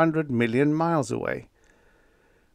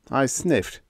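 plain reading voice

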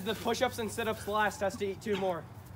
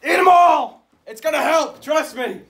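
shouted higher and louder; barking drill sergeant; 7 semitones higher, 13 dB louder than usual. Acted. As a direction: yell it down at recruits. Sergeant ordering recruits to eat.

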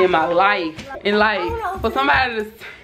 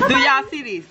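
ordinary talk, nothing marked

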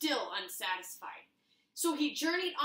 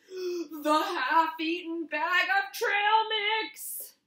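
higher, louder, very slow build; dramatic horror reveal; 6 semitones higher, 9 dB louder, much slower than usual. Acted. Revealing the scariest creatures in the trash bin, slow and ominous. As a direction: unveil the terrible horde.

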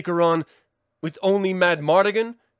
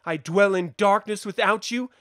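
usual speaking voice

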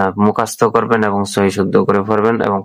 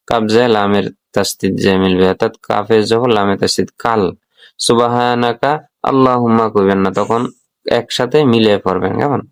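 ordinary talk

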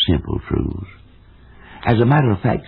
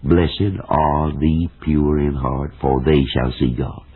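slow, deliberate quote; 3 semitones lower, slower than usual. Shown as a demonstration. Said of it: "Every word in that statement is a monosyllable".

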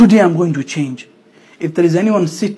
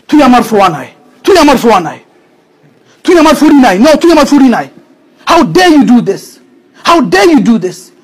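higher, louder furious scolding in Bengali and English; angry parent; 6 semitones higher, 7 dB louder than usual. Retold or imitated. Hurt parent shouting at a child who shamed them. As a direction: lash out like a deeply hurt parent. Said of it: "because of that hurt, they say certain things".

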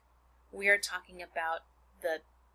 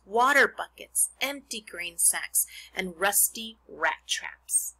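put-on expressive read-aloud to children; reading a book to a class; story narration; 5 dB louder than usual. Reads Charlotte's Web aloud.

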